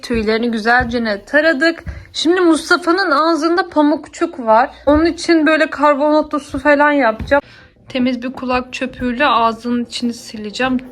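normal talking voice

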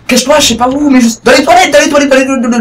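high, loud frantic rush; panicked urging. Real, not acted. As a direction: scramble for cover in panic. Panicked hiding from monster. (panique pressante)

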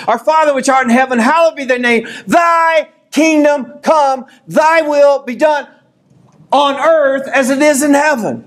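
high, building shout of the Lord's Prayer, slowing at the peak; preacher shouting the Lord's Prayer to his congregation; preaching at full force. Acted. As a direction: lift the prayer to the rafters. Recites Lord's Prayer climactically.

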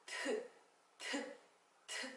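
트 whispered with no voice; whispered t sound. Shown as a demonstration. Teacher demonstrating the t sound to pupils, whispered. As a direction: breathe 트 out without voice. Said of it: "그 다음".

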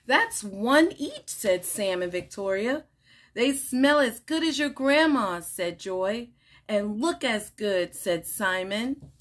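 animated picture-book read-aloud with lively character lines; reading to children. Acted. Teacher reading a picture book to children, bright and animated. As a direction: read it like story time for little kids.

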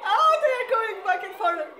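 high delighted shrieks and laughs; excited screaming. Real, not acted. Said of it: "I just cannot control those screams".

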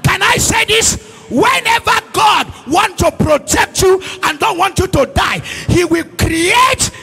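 forceful, full-voiced sermon; full-force preaching. Real, not acted. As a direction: press the point on the congregation.

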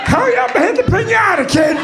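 put-on high, loud yell at the kid to hurry; yelling dad. Dad yelling at his kid to hit the piñata, high and loud. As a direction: bark it like an impatient dad.

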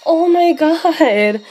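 normal talking voice

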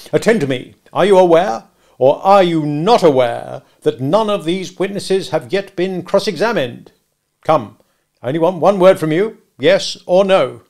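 hectoring courtroom voice; bullying cross-examiner. Acted. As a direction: hammer the witness for an answer. Stranger cross-examining Mister Wopsle.

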